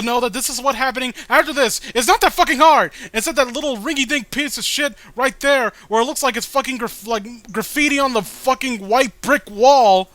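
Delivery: high, loud outburst of disgust; exasperated rant. Real, not acted. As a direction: let the frustration burst out high and loud. Ranting at the comic's panels.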